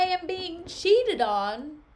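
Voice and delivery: loud, drawn-out indignant finish. Acted. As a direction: stretch each word in mock outrage. Othello's paraphrase peaks in indignation.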